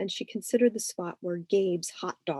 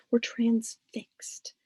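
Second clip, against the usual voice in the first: slow whisper; 4 semitones higher, much slower than usual. A narrator's reading. Narrator whispers, slowed for effect.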